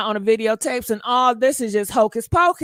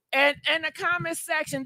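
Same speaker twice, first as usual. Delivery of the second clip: ordinary delivery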